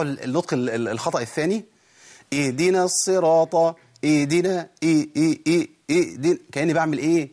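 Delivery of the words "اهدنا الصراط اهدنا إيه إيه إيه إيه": "اهدنا" cut to ee'-dina with a glottal catch; ha replaced by hamza. Shown as a demonstration. Teacher shows the second mistake, hamza for ha. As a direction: stop the breath after the first vowel of "اهدنا". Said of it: "كأني بأبدل الهاء بهمزة".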